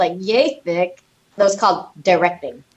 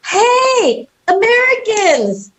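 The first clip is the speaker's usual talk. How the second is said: put-on drunk producers; 13 semitones higher, 3 dB louder, much slower than usual. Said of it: "And they were like".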